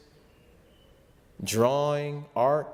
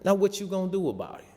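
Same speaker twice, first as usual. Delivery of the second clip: higher, fast, cocky dare to the camera; smug mock challenge, tossed off quickly; a smirking villain daring the viewers; taunting beast on TV; 8 semitones higher, faster than usual. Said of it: "look at the camera and be like".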